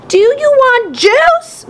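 high, slow scream; screamed question. A narrator's reading. Mom screams her question over the blaring TV. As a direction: scream it across the room. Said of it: "Mom screamed".

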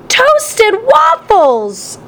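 high, slow bellowed reply; yelled answer. A narrator's reading. Dad yells his breakfast order back to Mom. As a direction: yell it out over the TV. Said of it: "Dad yelled louder".

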